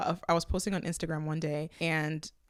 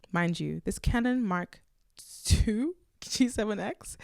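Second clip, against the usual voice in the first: ordinary talk, nothing marked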